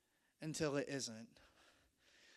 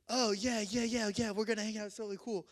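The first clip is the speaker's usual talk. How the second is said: higher, louder gushing yeses; mock eager agreement; 5 semitones higher, 4 dB louder than usual. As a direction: imitate an over-keen friend saying yes. Friends eagerly agree to hang out.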